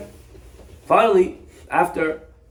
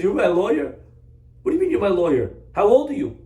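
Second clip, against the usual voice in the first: incredulous defendant; 8 semitones higher, faster than usual. Acted. Defendant's disbelief at kid lawyer.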